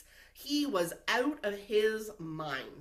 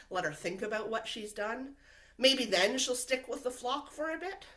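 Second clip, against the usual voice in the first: normal talking voice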